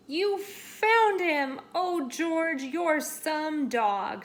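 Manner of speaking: warmly thrilled thanks; joyful praise. Acted. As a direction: gush with relief over the dog. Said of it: "said the man".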